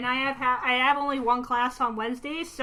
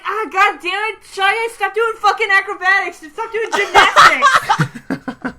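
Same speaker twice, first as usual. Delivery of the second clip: higher, louder half-laughing frustration at the game; re-enacted angry outburst; 8 semitones higher, 10 dB louder than usual. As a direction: redo the angry rant for the friends, laughing. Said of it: "say how you said it".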